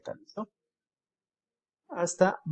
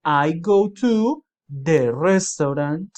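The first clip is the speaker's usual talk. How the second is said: very slow and louder example answer; slow louder model; 6 dB louder, much slower than usual. Shown as a demonstration. Teacher to students, modelling the answer very slowly. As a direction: stretch out each word for the class. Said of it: "yo voy a decir".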